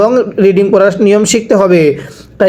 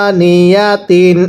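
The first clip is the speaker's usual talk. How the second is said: teacher's slow model reading of আনিয়াতিন; slow drawn-out reading; much slower than usual. Shown as a demonstration. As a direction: stretch আনিয়াতিন out very slowly, each syllable held. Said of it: "আরো একবার পড়ব".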